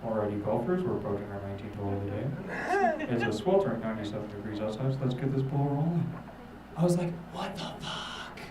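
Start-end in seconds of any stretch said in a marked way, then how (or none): 0.0-6.1 low, hushed golf broadcast voice; golf commentator. Acted. TV commentator to the golfers on a sweltering day, low golf-announcer voice. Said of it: "I hear the most beautiful sound". 7.3-8.4 slow, breathed-out astonishment; awed whisper. Acted. Stoner hearing golf from the stairs, slow awed whisper.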